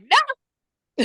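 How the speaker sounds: high, loud retold "no"; high-pitched, punchy mock protest; emphatic mock no. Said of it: "And I'm like".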